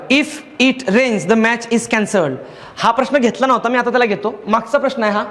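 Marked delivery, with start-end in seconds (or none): 0.1-2.3 very slow reading of the question; slow dictation. Shown as a demonstration. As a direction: read it out word by word. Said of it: "पहिलं वाक्य बघा".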